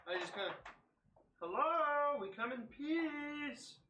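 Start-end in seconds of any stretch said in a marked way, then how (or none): 1.4-3.8 high, drawn-out greeting call. Acted. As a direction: call out to strangers, stretching the vowels. Character calls out to strangers.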